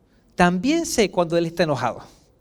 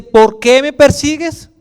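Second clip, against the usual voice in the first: loud, raised, slow booming question; proclaimed loudly from on high; voice from heaven; 6 semitones higher, 10 dB louder than usual. Acted. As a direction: call it out like a voice from the sky. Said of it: "una voz del cielo que le dice".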